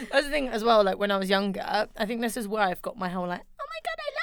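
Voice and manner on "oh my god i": squealed much higher, lovestruck; gushing fangirl. Retold or imitated. Remembering her fangirl days, she squeals much higher. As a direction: gush like a teen fangirl. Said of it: "I was a bit of a fangirl".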